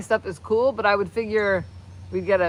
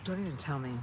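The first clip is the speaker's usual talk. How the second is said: ordinary delivery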